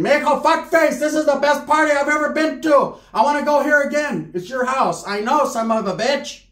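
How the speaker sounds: high, giddy auntie voice; high voice of a giddy drunk aunt; tipsy aunt voice. Retold or imitated. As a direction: gush like a drunk tia at a party.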